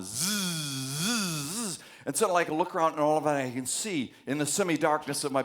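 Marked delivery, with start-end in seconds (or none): 0.0-1.8 imitated electric buzz on zzz; buzzing sound. Lecturer imitates the buzzing heard in sleep paralysis, buzzing. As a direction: buzz through the teeth like a hum. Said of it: "hear this buzzing sound".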